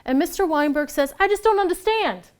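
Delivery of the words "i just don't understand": put-on Brooklyn accent. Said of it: "And he's from Brooklyn".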